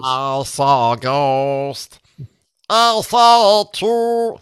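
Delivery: high squeaky slowed chipmunk singing. Shown as a demonstration. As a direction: sing it like a sped-up chipmunk. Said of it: "try doing this song with the chipmunk voice".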